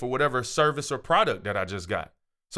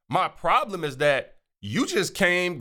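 ordinary delivery

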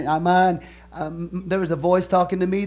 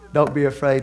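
ordinary talk, nothing marked

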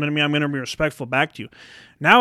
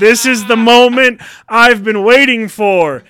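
slow, very loud, high proclamation; epic climactic shout; 7 semitones higher, 9 dB louder, slower than usual. Acted. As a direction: stretch every word into a triumphant roar. Repeats it bigger, drawn out.

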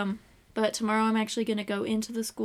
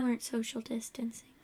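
teasing whisper; 7 dB quieter than usual. Real, not acted. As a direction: whisper it like gossip.

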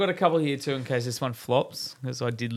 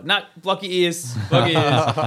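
put-on raised, louder playful order to a kid; mock urgent warning; 4 semitones higher, 7 dB louder than usual. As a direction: imitate a dad hushing his daughter, louder. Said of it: "You tell her".

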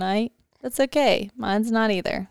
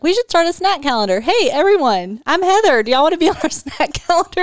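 high, louder, chirpy announcement; mock eager mom; 8 semitones higher, 4 dB louder than usual. Friend teasing Heather's eager snack calendar pitch, high and bubbly. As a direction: gush it like an overeager team mom. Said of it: "She's like".

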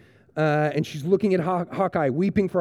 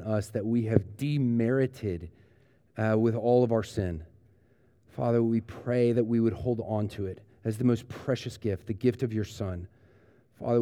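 prayer; 3 semitones lower, 3 dB quieter than usual. Acted. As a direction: lead the congregation gently in prayer. Closing prayer.

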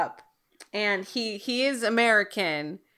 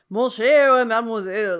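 put-on French accent with big swooping inflections; louder, showy cartoon Frenchman; French Lumiere impression; 5 dB louder than usual. Imitating the cartoon candelabra's actor for a co-host, French accent. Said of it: "big inflections".

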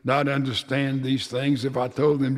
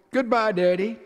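a higher child's call, lightly voiced; called goodbye; 8 semitones higher than usual. Little son waving to his departing father, lightly voiced child call. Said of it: "turned and waved a hand and called".

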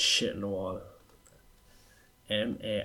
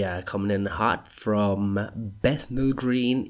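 normal talking voice

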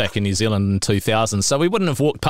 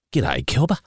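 put-on Australian accent; 3 semitones lower than usual. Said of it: "I'm sure you can guess".

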